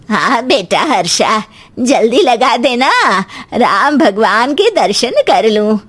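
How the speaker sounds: slow, coaxing elder voice; pleading older woman, unhurried; mother-in-law. Acted. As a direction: draw the request out slowly. Mother-in-law asks in story.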